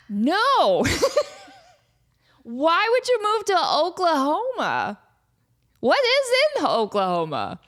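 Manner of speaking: laughing, high "why would you" in disbelief. Real, not acted. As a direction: laugh it off, amused and incredulous. Laughing at viewer question.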